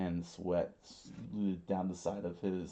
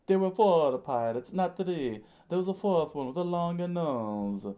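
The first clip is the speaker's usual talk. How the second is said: high, loud panicked voice; loud cartoon panic; freaking out; 10 semitones higher, 8 dB louder than usual. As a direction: protest frantically, pitched way up. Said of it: "Mister Three freaked out".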